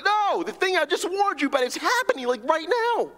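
urgent exasperation. Retold or imitated. Preacher voicing Jesus exasperated at his disciples, high and urgent. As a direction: make them see it's happening now. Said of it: "And he's like".

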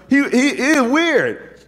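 slow, drawn-out high emphasis; emphatic. Real, not acted. Preacher stressing how odd John was, drawn out. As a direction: lean hard on weird.

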